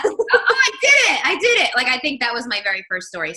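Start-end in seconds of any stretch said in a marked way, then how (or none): 0.0-1.7 high, loud thrilled squeal; excited squeal. Retold or imitated. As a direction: squeal with first-time triumph. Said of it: "I was like".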